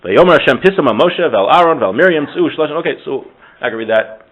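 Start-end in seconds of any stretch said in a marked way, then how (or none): none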